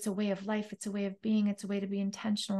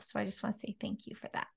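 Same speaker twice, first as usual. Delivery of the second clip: fast, near-voiceless, much softer thanks; choked-up thanks; 10 dB quieter, much faster than usual. Real, not acted. Thanking the host for her movement, softly choked up. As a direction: barely get the words out through emotion.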